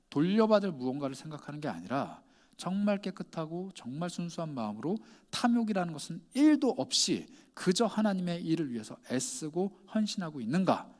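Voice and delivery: ordinary delivery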